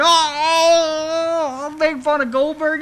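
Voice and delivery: delighted realisation. Retold or imitated. Realising the Goldberg parody idea.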